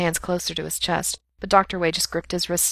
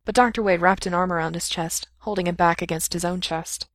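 ordinary delivery, nothing marked

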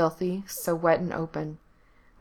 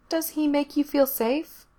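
put-on much higher child voice; little girl voice; 9 semitones higher than usual. A narrator's reading. Little girl waking Frank at night, high child voice. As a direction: voice the small girl, high and bright.

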